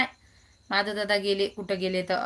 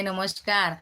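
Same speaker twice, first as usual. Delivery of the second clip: ordinary talk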